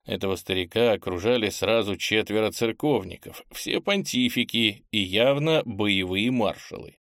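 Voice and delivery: ordinary delivery, nothing marked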